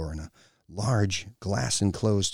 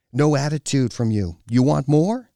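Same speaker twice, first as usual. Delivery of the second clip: louder, higher warning rising into a challenge; stern threat; 6 semitones higher, 6 dB louder than usual. A narrator's reading. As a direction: dare him to talk back. Woman threatens him with whip.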